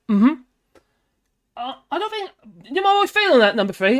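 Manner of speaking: ordinary delivery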